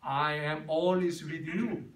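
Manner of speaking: each word drawn out very slowly; slow English quote. Shown as a demonstration. Pastor reciting the verse to the congregation, very slow. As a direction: savor the promise word by word.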